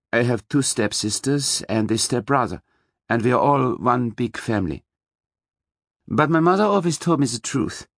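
put-on German-accented woman telling her family history; measured, candid German-accented voice; soft German accent. A narrator's reading. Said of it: "spoke in a soft German accent".

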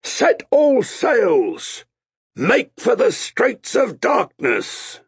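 drawn-out high bellowed command; shouted. A narrator's reading. Captain ordering the crew to sail, shouted. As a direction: bellow slowly to the whole ship. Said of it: "Amos shouted".

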